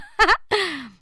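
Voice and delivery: high, loud burst of laughter. A narrator's reading. Nicola laughing in the car, loud burst. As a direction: let the laugh roll out. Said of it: "lachte Nicola laut los".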